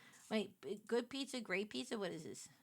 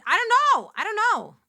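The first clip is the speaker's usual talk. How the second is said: much higher and louder, throwing up her hands; exasperated outburst; 10 semitones higher, 13 dB louder than usual. Real, not acted. Streamer baffled by chat's pizza votes, loud and high.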